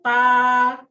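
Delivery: TA and A held high and level; long, unwavering high TA; first tone TA. Shown as a demonstration. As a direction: sustain TA, then A, on one high pitch. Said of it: "Ah, itu nada satu".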